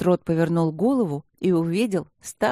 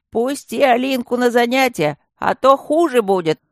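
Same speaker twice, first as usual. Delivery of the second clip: tearful threat; 7 semitones higher, 6 dB louder than usual. A narrator's reading. Wailing Kamen threatens.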